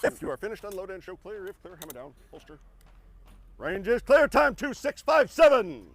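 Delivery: range officer commands. Real, not acted. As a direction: recite the safety commands, then shout the finish.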